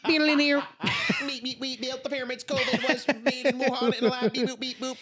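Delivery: high, loud beeping alien voice. Pretend. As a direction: beep boop like a little alien. Robotic alien conspiracy bit.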